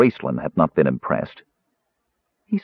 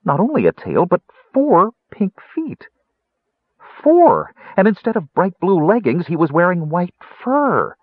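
astonished; 8 semitones higher, 4 dB louder than usual. A narrator's reading. Astonished at his mouse body.

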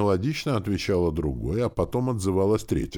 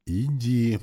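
firmer, slightly raised repeat; a bit louder; 6 semitones higher than usual. A narrator's reading. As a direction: push it a touch more. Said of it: "повторил он чуть громче".

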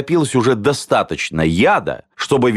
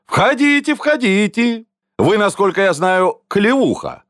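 high-pitched, effusive host; exclaimed welcome; 10 semitones higher than usual. A narrator's reading. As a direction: usher guests in with showy delight. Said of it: "воскликнул он". (радушно восклицая, высоким голосом)

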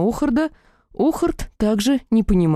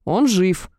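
barking. A narrator's reading. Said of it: "я рявкнула".

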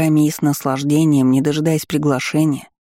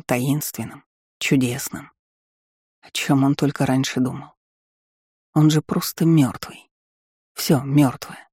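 hushed bleak narration. A narrator's reading. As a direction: read it like a numb cold dream. Dreamlike cold despair narration.

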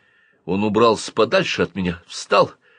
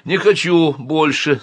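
slow, higher-pitched protest; resisting; 7 semitones higher, 4 dB louder, slower than usual. A narrator's reading. Boy refuses more porridge, resisting. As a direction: refuse it, dragging the words. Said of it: "сопротивляясь, сказал я".

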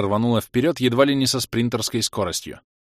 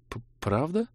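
higher, softer stammered question; shy surprise; 6 semitones higher, 5 dB quieter than usual. A narrator's reading. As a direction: ask in flustered disbelief. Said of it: "неожиданно спросила". (робко, удивлённо)

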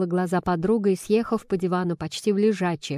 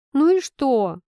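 high, louder plaintive protest; plaintive whimper; 6 semitones higher, 5 dB louder, slower than usual. A narrator's reading. Heroine answers her friend, plaintive whimper. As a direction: protest as if near tears. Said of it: "жалобно всхлипнула я".

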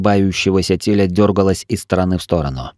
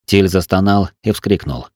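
ordinary delivery

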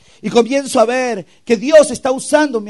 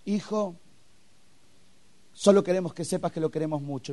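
hushed, lower, tender voice with long pauses; tearful father, retold softly; 5 semitones lower, 12 dB quieter than usual. Father to son before he leaves, soft and moved. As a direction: quietly voice a moved father, holding back. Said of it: "yo vi a llorar a mi papá".

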